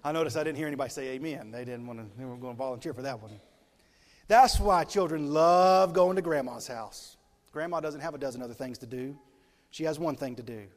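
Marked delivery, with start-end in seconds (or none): 4.3-7.2 louder, slow proclamation; slow, loud pulpit emphasis; emphatic preaching. Acted. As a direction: drive the point home to the congregation.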